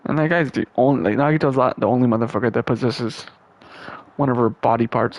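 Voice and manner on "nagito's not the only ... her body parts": ordinary talk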